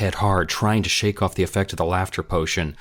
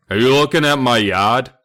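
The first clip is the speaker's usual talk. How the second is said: bully voice; 6 semitones higher, 7 dB louder than usual. A narrator's reading. Bully confronts kids.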